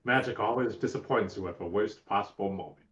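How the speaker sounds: ordinary talk, nothing marked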